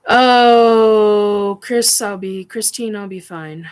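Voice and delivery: loud crying oh, then settling; overwhelmed voice; emotional cry. Real, not acted. Overwhelmed, reassuring friend.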